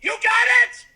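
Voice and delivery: rising into a furious shout; calm turning angry. Pretend. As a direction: build from firm refusal to yelling. Refusing the officer, escalating.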